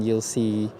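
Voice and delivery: ordinary delivery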